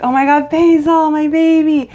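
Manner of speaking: put-on high, loud tearful baby talk; overjoyed cooing. Holding her cat again, high loving gush. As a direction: gush over him like a long-lost baby.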